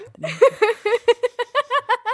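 high laughing burst. Real, not acted. Big laugh at the joke.

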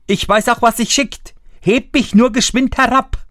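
high, firm little voice; high piping refusal; tiny Daumestick. A narrator's reading. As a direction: insist like a proper little fellow. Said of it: "sprach Daumestick".

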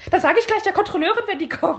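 high whiny telling-on voice; whiny tattletale. Retold or imitated. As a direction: tattle like a petty snitch. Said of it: "dreht sich dann um zu der Frau und sagt".

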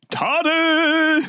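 very high mock cry of the punishment; teacher shouting. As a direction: pitch it way up like an outraged teacher. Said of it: "und auf einmal". (Lehrer ruft, sehr hoch: Tadel!)

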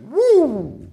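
sudden shrill falsetto whoop of triumph; high whoop. Real, not acted. Celebrating blocking the date, high whoop. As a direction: let out a victory woo.

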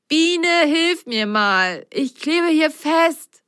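slow, high plea for help; stuck, pleading. Acted. Ben stuck in glue. (flehend, festgeklebt)

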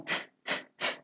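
"É f, f, f" breathed with no voice; a soft airy hiss for "É f, f, f"; only air. Shown as a demonstration. Teacher showing the unvoiced th sound. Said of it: "só ar".

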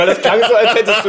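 teasing, words breaking into laughter; laughing. Real, not acted. Teasing laughter about leaving Saxony.

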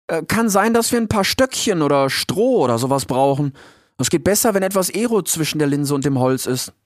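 put-on a shy young fox offering an idea. Fox Rix voiced.

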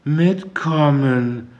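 slow, high, mumbled, as if in a daze; dazed mumble. A narrator's reading. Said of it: "nuschelte sie weiter".

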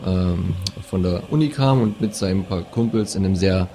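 usual speaking voice

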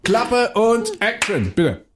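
high, slow drawn-out "Klappe und Action"; director's call. Acted. Director starting the scene, drawn-out call. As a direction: call the take like a film director. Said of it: "director calling take".

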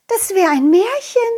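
put-on high girl voice; little girl wondering; butterfly girl.

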